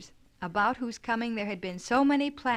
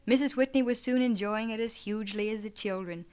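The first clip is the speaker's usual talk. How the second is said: ordinary delivery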